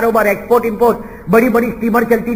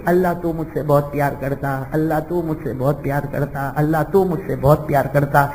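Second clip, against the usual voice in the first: tearful prayer; 6 semitones lower, 5 dB quieter than usual. Acted. Slave girl praying while weeping.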